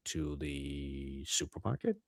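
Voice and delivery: low, drawn-out retold answer; hesitant reply. Man answering his date in the car, slow and hesitant.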